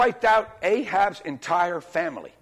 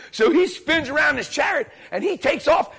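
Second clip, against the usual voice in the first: ordinary delivery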